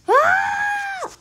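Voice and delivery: very high piercing shriek; terrified scream. Real, not acted. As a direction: scream in panic. Falling, screams.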